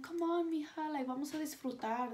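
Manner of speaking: high, coaxing aunt voice; a lightly voiced aunt urging her niece along. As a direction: coax the niece out to have fun.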